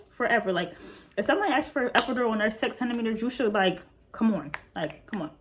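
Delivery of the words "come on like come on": normal talking voice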